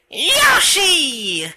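high, drawn-out name call; jump battle cry. Shown as a demonstration. Yoshi teaching T-Man to jump, high drawn-out cry. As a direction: shout it as you spring up.

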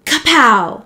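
loud, punchy kapow; swat sound. A narrator's reading. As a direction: burst out with a loud smack effect. Swat that answers the mosquito.

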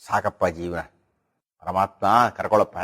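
put-on low, soft tired old man's lament; weary old man. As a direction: sigh it out like a worn-out old man. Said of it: "ಮೆತ್ತಗಾಗಿರತಕ್ಕಂಥ ಮುದುಕರ ಮಾತುಗಳನ್ನು ಕೇಳಬೇಕು".

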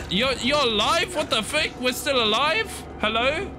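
very high-pitched disbelief; incredulous. Real, not acted. Gamer finding they're still alive, high-pitched incredulity. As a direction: squeal it in stunned surprise.

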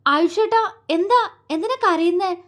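high-pitched anxious questioning; flustered concern. A narrator's reading. Paru rushing to the crying Ayush, flustered. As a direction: ask in worried agitation. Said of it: "അവൾ വെപ്രാളത്തോടെ അവനടുത്തേക്ക്".

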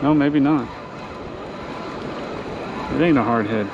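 ordinary talk

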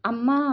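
sharp loud yelp after a slap; cry of pain. A narrator's reading. Slapped younger sister crying out to mother. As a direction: cry out as if struck. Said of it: "అరిచింది".

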